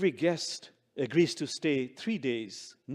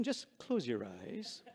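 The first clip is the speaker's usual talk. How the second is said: slow, softer invitation; meditation guide; 4 semitones lower, 9 dB quieter, slower than usual. Acted. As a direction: ease them into calm. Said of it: "do a short meditation".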